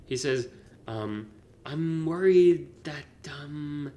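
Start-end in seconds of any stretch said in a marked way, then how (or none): 1.7-3.9 saying it weird. Retold or imitated. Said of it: "That's why I'm saying it weird".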